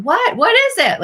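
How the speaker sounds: put-on high-pitched eager little girl; imitating an excited, expectant child. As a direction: jump up high with eager curiosity. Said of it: "she's eager for this".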